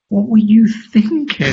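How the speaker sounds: wanting to yell at the injured dad, half-voiced exasperated scream; mock scream. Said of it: "like, scream at him was like".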